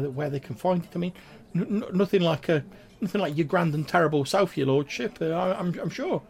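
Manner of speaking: high-pitched flustered little sprite, full of false starts; Grimwald, panicked stammer. Acted. Humble sprite explains his work to his lordship, stammering squeak. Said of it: "he's, like, stammering over his words".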